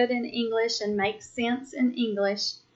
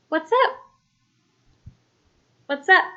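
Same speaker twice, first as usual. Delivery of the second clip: excited greeting; 7 semitones higher, 5 dB louder, faster than usual. Shown as a demonstration. Casual greeting in sign practice.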